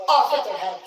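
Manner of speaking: high, loud mock-royal shout; hammed-up yelled decree; mock royal command. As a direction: cry out the sentence like a tyrant. Acts out an execution order.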